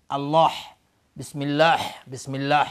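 «الله» ending in raspy ḥ; wrong final ha. Retold or imitated. Teacher imitates how some pronounce Allah, wrong final ha. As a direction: end «الله» on a strong mid-throat ḥ.

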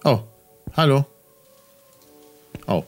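normal talking voice

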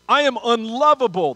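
ordinary talk

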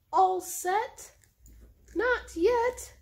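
high, loud squeaky questions; playful dialogue voices. Acted. Voicing duck and cat for her pets, high and loud. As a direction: bounce the two lines like cartoon characters.

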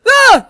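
short, high, loud yelp; startled scream. Acted. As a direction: yelp as if caught off guard. Startled by the sudden greeting.

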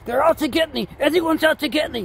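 high, frantic alarm; comic paranoid turkey; paranoid panic. Acted. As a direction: sound hunted and frantic. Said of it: "You're drowning in paranoia".

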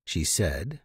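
ordinary delivery

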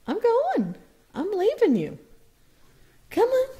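high coaxing pretend goodbye; mock leaving. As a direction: playfully pretend to walk off. Pretending to leave, coaxing bird.

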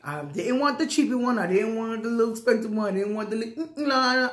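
ordinary delivery

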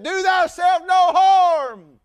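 loud, high urgent shout; Paul's cry. Acted. Paul calls to the jailer not to kill himself, loud high shout. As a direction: cry out to stop him. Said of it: "Paul cried".

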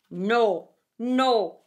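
stern weasel. A narrator's reading. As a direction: flatly deny the bat. Weasel voiced in fable.

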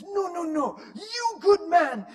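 put-on high, booming shout in a Spanish accent; hammed-up Spanish-accented yelling; aggressive Spanish man. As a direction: insist loudly, stretching the words. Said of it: "And he's so aggressive".

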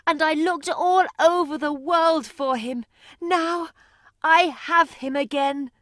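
high, overjoyed mother's voice; a mother who has found her son; crying with joy. Acted. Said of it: "started to cry with joy".

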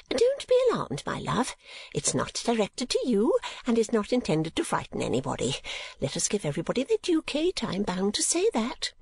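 high, fussy, reassuring mother; Mrs. Nickleby. Acted. Mother telling her daughter not to be alarmed, high and fussy.

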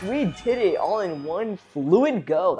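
slow, drawn-out high, loud celebration; triumphant cheer. Real, not acted. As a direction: stretch the build-up, then burst out cheering. Celebrates beating the boss.

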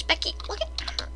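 hushed mock-gossip whisper; whispered aside. Whispered aside after exclaiming.

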